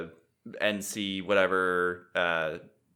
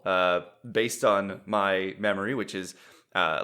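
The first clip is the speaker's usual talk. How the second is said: usual speaking voice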